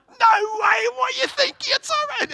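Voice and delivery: very high squeal of disbelief; mock outrage. Preacher scolding congregation over their vote on the battle, mock outraged. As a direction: shriek in hammed-up incredulity.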